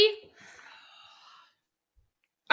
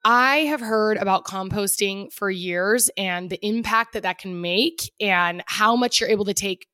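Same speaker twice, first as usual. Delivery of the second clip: ad read; 3 semitones lower than usual. Acted. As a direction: read the sponsor spot. Sponsor read.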